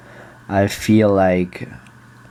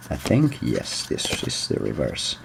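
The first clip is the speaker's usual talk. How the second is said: breathy whisper to himself; whispered aside; 5 semitones lower, 7 dB quieter than usual. Real, not acted. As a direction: mutter it under your breath. Muttering to himself over cards.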